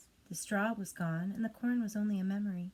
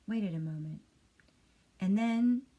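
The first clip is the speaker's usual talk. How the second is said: usual speaking voice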